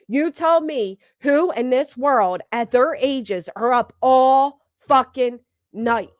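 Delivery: slow, leaning on each word; slow emphatic rant. Real, not acted. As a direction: drive every word home slowly. Ranting at viewers.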